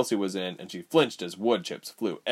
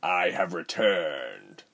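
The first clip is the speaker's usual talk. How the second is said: smug grin; 3 semitones lower, much slower than usual. A narrator's reading. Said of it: "Rubens called with a grin".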